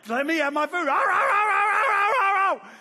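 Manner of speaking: high, loud yelping dog voice; dog begging. Retold or imitated. Dog on a leash begging for its food, high yelping. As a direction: whine and yowl like a hungry dog. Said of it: "you know, like".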